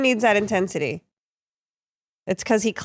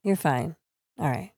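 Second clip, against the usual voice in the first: ordinary delivery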